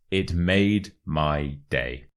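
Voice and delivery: word-by-word "It made my day"; measured teacher's demonstration, each word separate; slow model phrase. Shown as a demonstration. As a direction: present the phrase slowly for learners. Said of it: "the second expression is".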